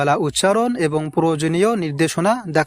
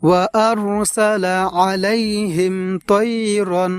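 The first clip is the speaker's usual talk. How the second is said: Quran recitation; 3 semitones higher, much slower than usual. Shown as a demonstration. Recites the verse.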